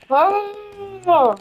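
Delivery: high swooping "ohh"; acted drawn-out "oh". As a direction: let a long oh rise high and fall. Acting out own wordless reaction.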